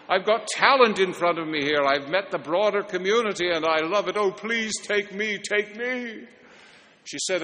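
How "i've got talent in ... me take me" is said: mock audition begging, climbing higher; hammed-up pleading. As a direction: beg theatrically to be picked, voice rising.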